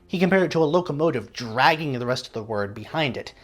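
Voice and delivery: ordinary talk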